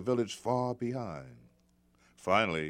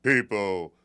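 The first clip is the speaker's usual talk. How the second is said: stern father; 7 dB louder than usual. Acted. Father warns his son.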